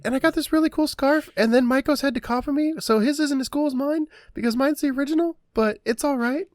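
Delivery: high, smug show-off voice; bragging teen. Acted. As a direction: brag about the scarf. Quinn bragging about scarf.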